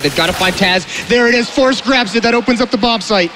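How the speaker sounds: excited play-by-play; esports caster. Acted. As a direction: call the big play. Live play-by-play call.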